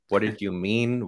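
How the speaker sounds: ordinary talk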